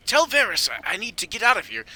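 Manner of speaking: high, loud prisoner's plea; acted urgent insistence; insistent demand. Prisoner demanding release from guard.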